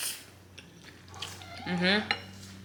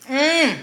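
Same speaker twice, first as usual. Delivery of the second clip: high delighted mmm; loud pleased hum; 11 semitones higher, 5 dB louder than usual. Real, not acted. Savoring food.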